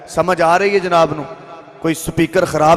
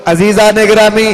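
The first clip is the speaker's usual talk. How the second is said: raised, ringing preacher's voice over music, stretching key phrases; preaching at full force; 4 semitones higher than usual. Acted. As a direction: build the miracle tale with fervour for the congregation. (जोश नाल उच्ची आवाज़ विच was)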